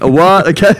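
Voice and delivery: puzzled American call-centre worker, hammed up; American accent. Call centre hearing of a cassowary attack, mock American accent. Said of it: "I'm doing an American accent".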